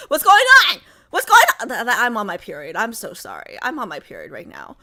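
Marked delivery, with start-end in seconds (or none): 0.1-1.7 high, loud, frantic shriek; hammed-up panicked screaming; mock screaming. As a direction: scream it like the whole thing is unfair. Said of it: "lightheaded right there screaming".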